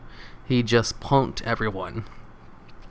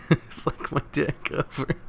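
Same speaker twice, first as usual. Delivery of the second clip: laughing; 3 semitones higher, 5 dB quieter, slower than usual. Real, not acted. Laughs at story.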